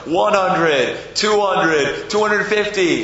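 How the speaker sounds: high, loud, rhythmic count; crowd counting aloud. Acted. Everybody counting the money together, excitedly. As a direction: count up like a game show. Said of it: "everybody's counting with him".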